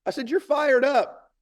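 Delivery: very high, loud, slow rallying cry; hyping up. Retold or imitated. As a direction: re-enact pumping up a scared child. Father hyping up his daughter.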